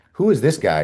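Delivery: high, loud, taken-aback challenge; shocked partygoer. Retold or imitated. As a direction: bristle at the stranger in disbelief. Partygoers confront drunk guest.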